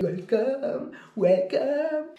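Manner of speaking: lightly put-on English welcome; welcoming host. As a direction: greet guests like an eager host. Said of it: "Position d'accueil".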